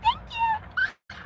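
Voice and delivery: put-on very high, squeaky kid's thank-you; giddy little kid. Wife thanking husband for a compliment, giddy little kid. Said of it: "Felt like a little stupid kid".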